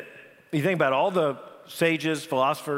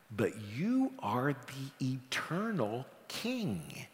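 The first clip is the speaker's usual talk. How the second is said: slow and much softer; awed hush; 4 semitones lower, 9 dB quieter, much slower than usual. Acted. Peter naming Jesus eternal King, slow, soft awe. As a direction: breathe it out in awe. Peter's confession, drawn out softly.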